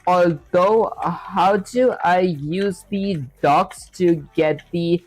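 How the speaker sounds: slowly sounding out typed words; slow dictation. Real, not acted. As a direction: dictate each word slowly while typing. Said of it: "let me actually say something like".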